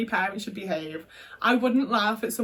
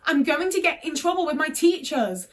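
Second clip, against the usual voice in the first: put-on whiny kid self; 5 semitones higher than usual. Mocking her younger self's plea.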